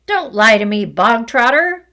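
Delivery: very loud barked order. Acted. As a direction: bark it at him. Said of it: "barked the Trunchbull".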